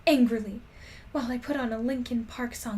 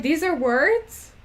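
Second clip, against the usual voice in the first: slow, louder, incredulous question; can't believe these are words; stretched-out bewildered exclamation; baffled disbelief; 5 semitones higher, 8 dB louder, slower than usual. Retold or imitated. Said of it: "I'm like".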